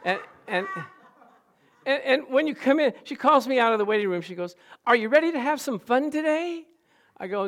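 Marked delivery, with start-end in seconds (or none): 4.8-6.7 high-pitched, gushing hygienist impression; overly happy hygienist. Hygienist calling a patient from the waiting room, too happy. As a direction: imitate someone far too cheerful. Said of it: "She's just too happy".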